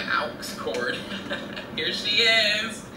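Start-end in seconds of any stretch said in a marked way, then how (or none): 1.8-2.8 mock grand announcement. Presenting her car like a star.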